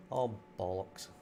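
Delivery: ordinary talk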